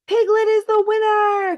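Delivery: high, loud announcement; announcing the winner. A narrator's reading. Kanga announces the winner at the finish line, excited. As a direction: proclaim the winner with a flourish. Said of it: "Kanga announced".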